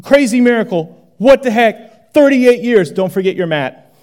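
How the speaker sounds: ordinary talk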